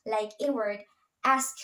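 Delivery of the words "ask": ask, no vowel inside the cluster; tight SK cluster. Shown as a demonstration. Student teacher giving English cluster example ask, tight SK cluster. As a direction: ask with S and K joined. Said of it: "the S K T clusters".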